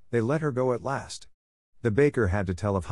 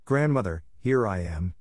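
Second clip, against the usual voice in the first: usual speaking voice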